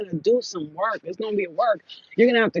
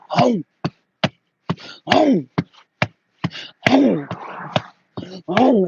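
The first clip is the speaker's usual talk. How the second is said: loud, abrupt repeated grunt with long gaps; evenly spaced heavy vocal thuds; giants' heavy steps; 3 dB louder, much slower than usual. Retold or imitated. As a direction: boom out each beat like a giant's footfall. Imitates giant women walking in step.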